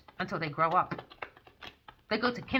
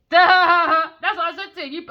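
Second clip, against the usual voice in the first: loud, high, through a big laugh; laughing outburst; 9 semitones higher, 12 dB louder, slower than usual. Real, not acted. Teasing him about the bedtime energy, laughing outburst. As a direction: burst out laughing into the words.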